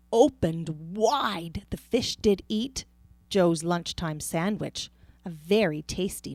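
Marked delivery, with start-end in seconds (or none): none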